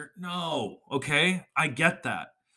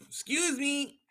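ordinary delivery

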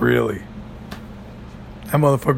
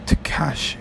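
whispered name; 5 dB quieter than usual. Pretend. Sounding out the rapper's name.

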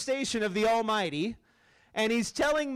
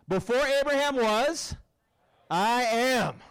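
higher, louder proclamation of "Before Abraham was, I am"; bold declaration; 3 semitones higher, 3 dB louder, slower than usual. Shown as a demonstration. Congregation echoing Jesus, proclaimed loud and high. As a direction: declare it boldly. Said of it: "You're getting it".

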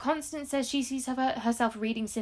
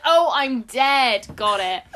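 slow dawning realisation; 3 semitones higher, 9 dB louder, much slower than usual. Acted. Acted quote of confused risen Cinderella.

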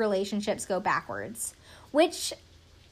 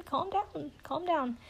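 higher, softer soothing; calming her down; 7 semitones higher, 9 dB quieter than usual. Retold or imitated. Fiancé cutting off his upset fiancée, soft soothing voice. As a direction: hush her gently, palms down. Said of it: "has to cut her off".

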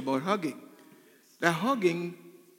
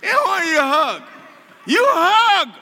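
loud, high, hammed-up protest; mock outcry; 9 semitones higher, 9 dB louder than usual. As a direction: cry it out in exaggerated objection. Acts out devil's objection to hugging.

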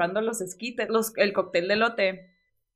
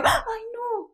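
much higher, pained "ay, no"; dismayed reaction; 13 semitones higher than usual. Retold or imitated. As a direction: wince at seeing the cricket squashed. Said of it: "esa fue mi reacción natural".

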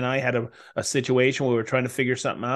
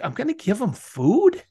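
mock indignation; 11 semitones higher, 3 dB louder than usual. Neighbor's retold sulky thought.

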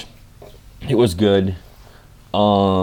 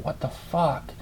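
shocked swearing; 7 semitones higher, 10 dB quieter, slower than usual. Retold or imitated. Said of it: "I'm like".

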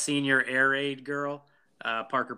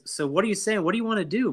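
higher-pitched quoted questions; acted movie questioner; 7 semitones higher, faster than usual. Podcaster acting out the back-seat exchange, high questioning voice. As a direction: lift the voice, playing a character prodding a friend.

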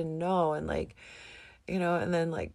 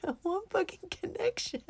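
excited; 13 semitones higher than usual. Retold or imitated. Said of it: "I'm like".

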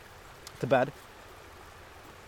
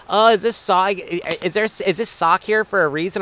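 mock girlfriend; 5 semitones higher than usual.

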